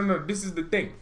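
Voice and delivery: normal talking voice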